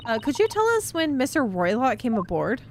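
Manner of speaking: usual speaking voice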